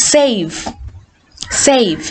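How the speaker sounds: ordinary talk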